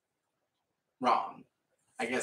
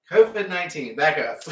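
sharp loud command; 4 semitones higher, 11 dB louder than usual. Retold or imitated. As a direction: bark it to back off. Shooing a crowding stranger.

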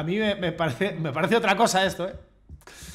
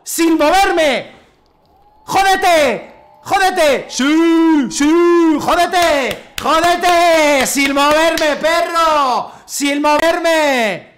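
alaridos triunfales, agudos y alargados; restriégales la victoria a grito pelado; 8 semitones higher, 6 dB louder, much slower than usual. Real, not acted. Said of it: "Eso de los gritos". (euforia desafiante a voces)

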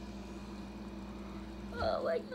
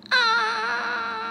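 imitating the video; 11 semitones higher than usual. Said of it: "the video went".

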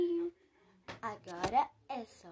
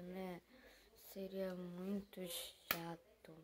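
whispering over a crib; 6 semitones lower, 13 dB quieter, much slower than usual. Acted. Quietly beside sleeping baby.

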